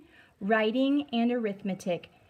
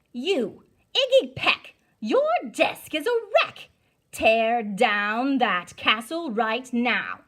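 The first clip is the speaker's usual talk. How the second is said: stern scolding teacher; 4 dB louder than usual. Acted. Teacher Miss Greer scolding Iggy for his castle, loud and stern.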